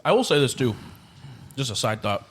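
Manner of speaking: talking with a mouthful of food; speaking around a bite of cake; mouth full. Real, not acted. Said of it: "There's food in my mouth".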